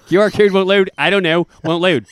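imitating a frustrated man.

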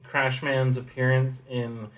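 ordinary talk, nothing marked

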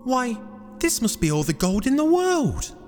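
high falsetto wonder; amazed exclamation. Acted. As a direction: marvel in a small, very high character voice. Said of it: "exclaimed Jack".

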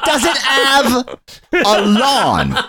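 put-on high boy's voice breaking into laughter; laughing in character. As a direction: giggle through the boy's line. Boy asking again, laughing.

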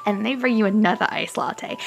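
ordinary talk

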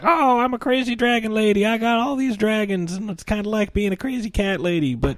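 high-pitched batty lady voice; crazy dragon lady. Retold or imitated. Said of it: "She's all like".

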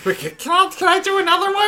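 high eager voice. Real, not acted. Eager to try another voice.

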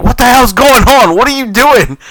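retold yell, high and loud; screaming. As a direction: shout it like a furious dad. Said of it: "my dad's screaming".

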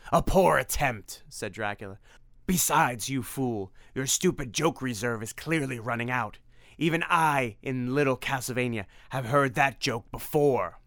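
sneering vampire. Acted. Dracula mocking Tim.

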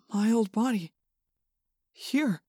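soft, hesitant wonder; doubtful. A narrator's reading. Tobias back in his old body, doubtful. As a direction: say it softly, not quite believing it. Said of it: "sounding like he doubted it".

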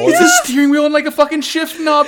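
high, loud gushing; excited yelling. Real, not acted. Host raving to co-hosts about a cool robot, excited yelling. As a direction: yell it out, thrilled and high-pitched. Gushing over the steering wheel.

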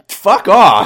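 high, loud exasperated outburst; mock anger. Host answering Ricky's taco reply, mock-angry outburst. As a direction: snap it in hammed-up annoyance.

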